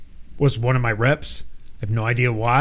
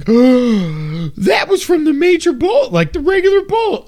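high, slow, louder mom voice; 13 semitones higher, 5 dB louder, slower than usual. Retold or imitated. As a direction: gasp it like an alarmed mother, way up. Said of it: "she was like".

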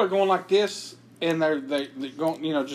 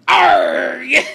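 loud pirate growl of a punchline; 5 semitones higher, 10 dB louder than usual. Acted. Answering a pirate riddle, loud pirate cry. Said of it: "What did the pirate say".

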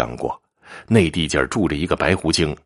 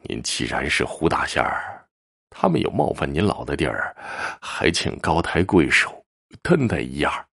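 softer, lowered, deferential voice; humble pleading; 5 semitones lower, 4 dB quieter than usual. A narrator's reading. Eldest brother begs the fox immortal's forgiveness, meek and hushed. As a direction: plead meekly and take your time. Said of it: "大哥低声下气地说".

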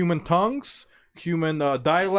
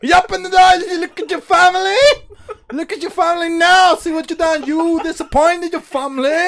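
much higher and louder cartoon scolding; South Park-style yelling; 10 semitones higher, 7 dB louder than usual. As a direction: scream it like a shrill cartoon tyrant. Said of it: "He sounds like a South Park character".

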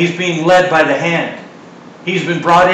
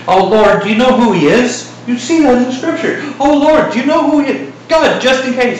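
much higher, anxious pleading; hammed-up panicky Ananias; questioning Ananias; 9 semitones higher than usual. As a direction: squeak out the worried question to God. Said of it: "he sure questioned it".

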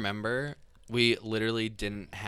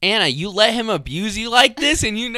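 mock outrage; 11 semitones higher, 8 dB louder than usual. Real, not acted. Teasing outrage at friend.

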